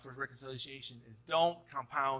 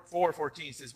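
ordinary delivery

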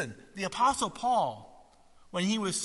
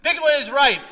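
even higher, loud motto; louder slogan; 8 semitones higher, 11 dB louder than usual. Retold or imitated. Preacher repeating the slogan, louder proclamation. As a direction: belt the motto again.

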